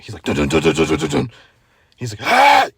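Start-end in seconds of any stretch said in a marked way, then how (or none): 0.2-1.3 rapid spoken knock noises; imitated frantic knocking. Storyteller mimicking the angry guy knocking on the car, frantic imitated knocking. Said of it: "the guy's, like, knocking, like, freaking out". 2.3-2.7 high, loud mock scream; imitated freak-out scream. Storyteller imitating the man freaking out at them, mock scream. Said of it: "He's, like, freaking out".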